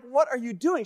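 high-pitched disbelieving question; incredulous. Retold or imitated. Husband confronts wife getting her coat on, incredulous.

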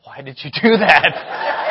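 slow, laughing mock question; amused wife asking why; wife's amused reproach. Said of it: "She's like".

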